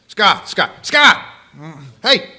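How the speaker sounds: loud mock yell, high on the last name; a parent calling a distracted kid, hammed-up. Mama calls her distracted son, a loud mock yell. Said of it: "Mama would say".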